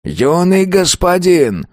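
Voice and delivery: high, slow desperate plea; pleading old spirit. A narrator's reading. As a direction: beg him to stop. Ghost pleads with master.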